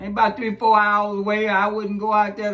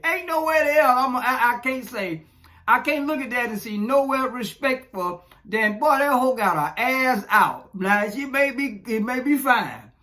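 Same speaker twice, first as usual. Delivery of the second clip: ordinary delivery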